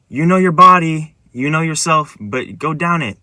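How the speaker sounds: high, loud call that fades as it goes; retold shout. Main guy in the dream shouting advice, retold shout. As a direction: imitate someone calling up the slope. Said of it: "that was shouting".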